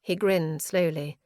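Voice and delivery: plain reading voice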